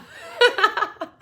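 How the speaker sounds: bright laugh, pitched high; amused laugh. Real, not acted. To the class, amused by her own Minnesota slip, laughing high. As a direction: laugh at yourself, caught off guard.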